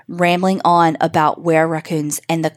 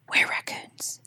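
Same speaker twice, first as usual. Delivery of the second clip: much softer, breathless whisper of terror; trembling whisper; 10 dB quieter than usual. A narrator's reading. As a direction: whisper it, frozen with fright. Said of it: "she uttered in a trembling whisper".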